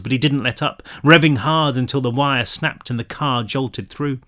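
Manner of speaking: ordinary delivery, nothing marked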